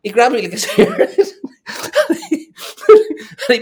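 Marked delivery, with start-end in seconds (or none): 1.1-3.4 loud laugh. Real, not acted. Laughing while telling the story.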